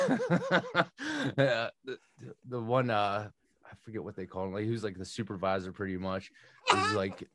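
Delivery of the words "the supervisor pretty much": normal talking voice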